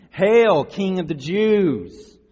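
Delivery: loud, high hail with drawn-out title; mock salute. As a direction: proclaim it like jeering soldiers. Soldiers' mock salute, acted loudly.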